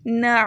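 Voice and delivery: نا from the throat; na with ain. Shown as a demonstration. Teacher showing نا. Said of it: "اسے ایسے پڑھیں".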